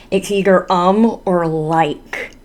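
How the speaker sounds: ordinary delivery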